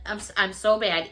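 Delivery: usual speaking voice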